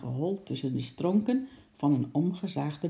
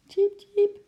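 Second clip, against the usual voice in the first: tiny high chirps; very high-pitched animal cheep; squirrel peeping; 6 dB louder than usual. A narrator's reading. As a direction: squeak it like a little squirrel. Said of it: "piepte ze zachtjes".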